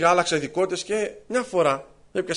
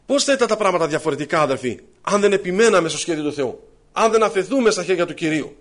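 preaching at full force; 3 semitones higher, 7 dB louder than usual. Acted. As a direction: proclaim it to the whole church. Preaching climax to the brothers.

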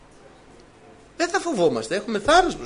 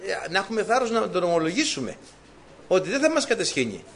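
ordinary talk, nothing marked

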